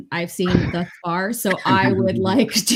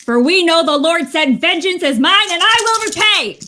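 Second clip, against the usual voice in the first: loud, high, righteous declamation; avenging nun at full voice; vengeful scripture cry; 9 semitones higher, 7 dB louder than usual. Acted. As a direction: thunder out the verse as a battle cry. Nun quotes scripture while opening fire.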